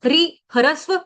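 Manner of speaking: ordinary delivery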